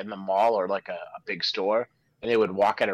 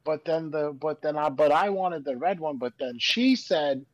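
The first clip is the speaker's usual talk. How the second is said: higher-pitched imitation of a rambling toddler; toddler telling everything; 7 semitones higher than usual. Toddler who finally has your attention, imitated rambling tattle. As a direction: imitate a little kid piling on "but then". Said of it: "Little kids, like toddlers".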